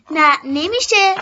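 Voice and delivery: frowning refusal. A narrator's reading. Girl frowning as she refuses the cheeky boy, firm and slow. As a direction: refuse him with a frown, slowly. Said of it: "اخم کردم و گفتم".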